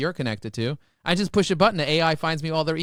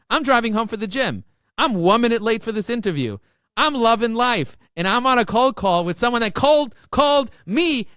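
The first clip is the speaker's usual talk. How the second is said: fired up; 7 semitones higher, 6 dB louder than usual. Real, not acted. Excited retelling.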